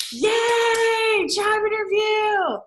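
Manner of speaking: loud high cheer, job interview drawn out. Real, not acted. Teacher reading a student's good news, cheering loudly. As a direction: celebrate with her, full voice. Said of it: "celebrating a student comment".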